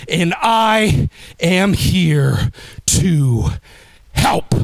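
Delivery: "and I am here to help" drawn out slowly, word by word; slow enunciation. Shown as a demonstration. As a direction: lay out each word slowly like a diction drill. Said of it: "I want you to enunciate and use diction".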